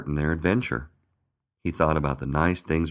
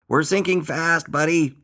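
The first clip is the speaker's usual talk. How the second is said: high, slow urgent shout; drawn-out high yell; 13 semitones higher, 6 dB louder than usual. A narrator's reading. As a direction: shout the alarm aboard a sinking ship. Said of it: "shouted Earl".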